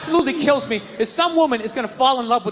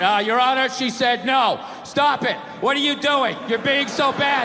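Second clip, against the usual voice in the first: deadpan courtroom readout; bad read. Court reader to the judge, bad wooden read. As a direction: read it out woodenly, stripped of all flirtation. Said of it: "you get a bad read and there's some guy reading it".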